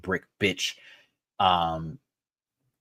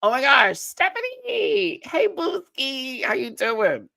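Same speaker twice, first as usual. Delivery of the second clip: excited greeting; slower than usual. Real, not acted. Greeting a viewer joining the stream.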